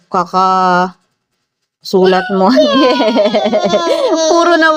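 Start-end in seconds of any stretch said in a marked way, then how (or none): 2.6-4.2 long, rippling laugh; amused laugh. Real, not acted. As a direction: laugh on and on, tickled. Amused at the child's drawing. (mahabang halakhak)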